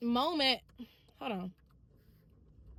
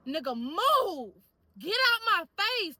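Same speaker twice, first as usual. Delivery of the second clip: retold angry outburst, louder and higher with drawn-out words; mean, aggressive; 7 semitones higher, 9 dB louder, slower than usual. Telling off someone who keeps trying to holler at her, mean. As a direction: snap into a loud, stretched-out brush-off. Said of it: "I immediately turn mean".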